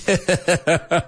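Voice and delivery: amused burst of laughter; short laugh. Real, not acted. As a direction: laugh at the slip. Laughs at own slip.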